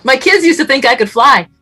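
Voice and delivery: real laugh bubbling through the words. Real, not acted. Laughing brag about kids.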